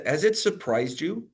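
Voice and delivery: ordinary talk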